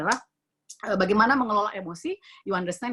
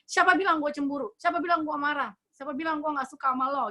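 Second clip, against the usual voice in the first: mock-defensive, pitched higher; defensive denial; 6 semitones higher than usual. A jealous person snapping back at a question, in a defensive denial. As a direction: deny it all, bristling and touchy. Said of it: "dia ngomong gini".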